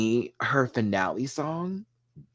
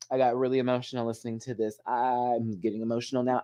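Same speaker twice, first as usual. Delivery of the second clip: ordinary delivery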